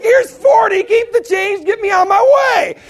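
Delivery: high, loud, jubilant shouting; so excited. Retold or imitated. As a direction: holler it in overjoyed relief. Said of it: "I was so excited".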